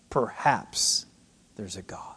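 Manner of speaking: very soft, trailing into a whisper; hushed doubt. Retold or imitated. Preacher voicing a scientist who can't grasp God, hushed. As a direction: let it drop away to a whisper.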